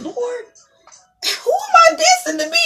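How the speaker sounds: high, slow pleading cry; crying out to God. Retold or imitated. Speaker retelling her prayer to God, high pleading. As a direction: lift it up high and plead with the Lord. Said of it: "going like".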